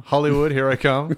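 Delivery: "Hollywood" and "come" belted out as a boast; mock triumphant yell. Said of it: "but I was like".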